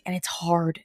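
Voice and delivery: whispered, breathy aside; a quiet confession to the viewers; hushed admission. Real, not acted. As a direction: let it slip out in a whisper. Admitting the struggle, almost whispered.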